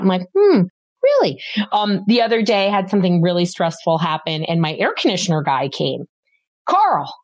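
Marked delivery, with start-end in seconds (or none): 0.4-1.4 sceptical hmm. Retold or imitated. To a parent who says they're not stressed, sceptical. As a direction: let them hear you don't buy it.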